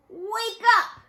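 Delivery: high, loud yell at a leaf. A narrator's reading. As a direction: bellow at the leaf to wake it. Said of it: "she yelled".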